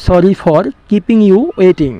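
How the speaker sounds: very slow, careful English; slow model sentence. Shown as a demonstration. Teacher giving the English translation, slow and deliberate. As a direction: lay each word out slowly. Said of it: "কিসের জন্য sorry?".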